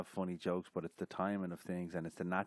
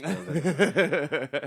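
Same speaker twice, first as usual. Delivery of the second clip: laughing; 7 semitones higher, 10 dB louder, much slower than usual. Real, not acted. Laughing at memory.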